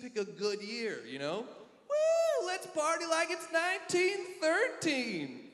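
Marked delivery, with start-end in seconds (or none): 1.9-5.5 whooping party cheer. Pretend.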